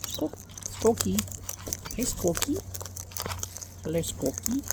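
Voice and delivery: high soft cooing to a bird. Real, not acted. Naming a new bird, high soft cooing. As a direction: coo the new name up high.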